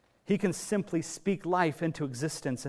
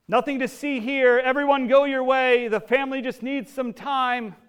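higher and louder, projecting over a crowd; crowd-dispersing call; 9 semitones higher, 7 dB louder than usual. Retold or imitated. Jesus sending the crowd away, loud and firm. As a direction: clear the street like a marshal.

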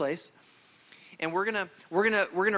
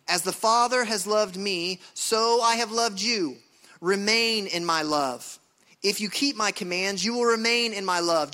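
ringing, emphatic Bible reading to a congregation; impassioned scripture reading; 3 semitones higher, 4 dB louder than usual. Real, not acted. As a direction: proclaim the verses with authority, stretching the words. Reads scripture with authority.

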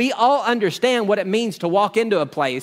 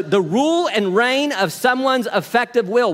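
usual speaking voice

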